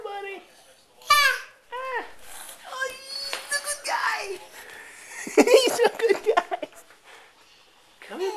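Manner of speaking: put-on high sing-song cooing at a toddler; baby talk. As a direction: coo at the baby, all delight. Cooing over walking baby son.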